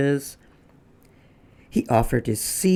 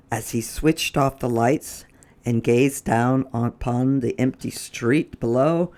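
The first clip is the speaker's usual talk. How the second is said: plain reading voice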